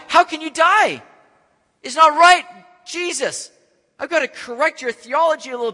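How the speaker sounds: high, loud protest; a high, loud, upset protest; Peter protesting. Retold or imitated. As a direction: object hard, can't accept it. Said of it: "begins to rebuke".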